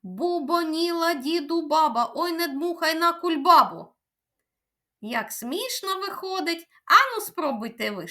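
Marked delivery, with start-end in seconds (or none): 0.0-3.9 rattled off at top speed; even faster. Shown as a demonstration. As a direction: race through the twister. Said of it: "іще швидше спробуємо". (ще швидше, на одному диханні)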